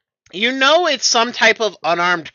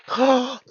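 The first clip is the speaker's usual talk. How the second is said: excited gasp; 6 semitones higher than usual. Acted. NPC gasps delightedly.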